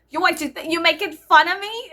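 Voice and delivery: indignant Bostonian, hammed up; Boston accent. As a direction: square up in a put-on Boston accent. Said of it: "too many Boston accents".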